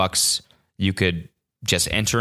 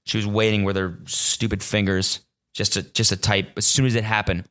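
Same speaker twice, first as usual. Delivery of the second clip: ordinary delivery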